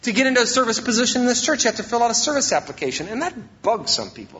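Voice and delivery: ordinary talk, nothing marked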